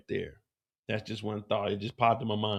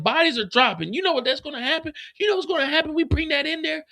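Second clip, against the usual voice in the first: much higher, rushed protesting woman; mock panicked woman; faster than usual. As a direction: squeal it like the scared officer. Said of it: "She said".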